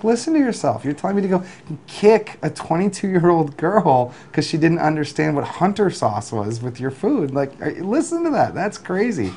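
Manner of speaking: pitched well up, like disbelief at a customer; mock outrage at an absurd request, voiced high; incredulous retort. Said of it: "I was like".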